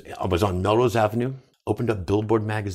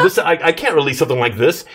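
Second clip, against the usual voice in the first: much higher, louder call to the engineers; retold yell; 5 semitones higher, 5 dB louder than usual. Singer yelling at studio engineers about his voice. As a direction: shout it across the studio in alarm. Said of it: "I'm yelling to these people".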